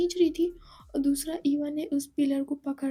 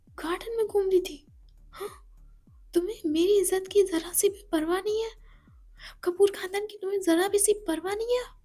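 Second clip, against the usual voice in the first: higher, louder jealous rant; angry scolding; 4 semitones higher, 4 dB louder than usual. Acted. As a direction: let the jealousy boil over. Ishani scolds Eva angrily.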